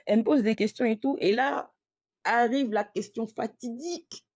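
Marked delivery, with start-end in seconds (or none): none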